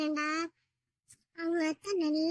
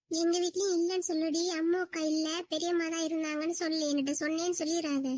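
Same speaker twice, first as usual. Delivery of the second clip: hurried instructions; faster than usual. Acted. Aunt hastily coaching the child.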